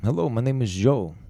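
mock Russian introducing himself; Russian accent. Shown as a demonstration. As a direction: attempt a Russian accent, not very well. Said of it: "No, this is way better".

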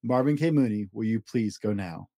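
ordinary delivery

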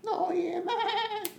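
very high squeaky put-on voice; comic Australian voice. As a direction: squeak it like an absurd Aussie character.

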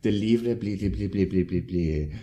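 gibberish French with a rapid run of "bli"; mock mumbly French. Friend retelling a mumbled insult in class, mock mumbly French. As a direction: babble "bli, bli, bli" as pretend French. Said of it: "some mumbly French insult".

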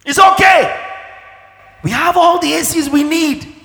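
high-pitched, full-voiced acted pleading; exasperated mock shouting. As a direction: shout it out as if begging givers to stop. Acts out refusing any more gifts.

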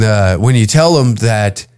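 normal talking voice

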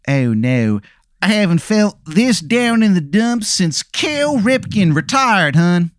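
slow, high Baltimore drawl; gloomy Baltimore hun; Baltimore accent. Acted. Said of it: "the worst Baltimore accent that you have ever heard".

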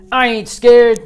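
loud, high defiant yell; full-force answering shout; team yelling back. A narrator's reading. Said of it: "The team yelled back".

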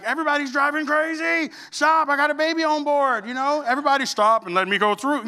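hammed-up frantic plea to other drivers; mock panicked new dad. New father driving baby home, mock frantic shouting. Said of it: "so scared".

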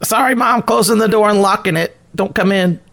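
put-on high, boyish voice; son calling to mom. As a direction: call back apologetically through the door. Son shutting out his mom.

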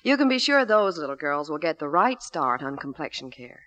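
ordinary delivery

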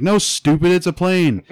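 put-on high, loud kid correcting his sister; scornful little-brother shout, hammed up; bratty brother. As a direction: play a boy yelling over his sister. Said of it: "and then her brother's like".

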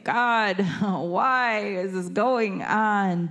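lightly voiced cry to God with a small laugh; mock lament, drawn out; pleading psalmist. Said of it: "what you see is, like".

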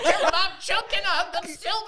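high, laughing mock choke; cracking up while pretending to choke.

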